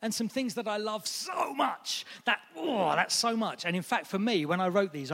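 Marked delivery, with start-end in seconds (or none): 0.0-3.6 high, slow gushing on so much; overflowing so much. Shown as a demonstration. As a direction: swoon over so much. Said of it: "the so much part of love".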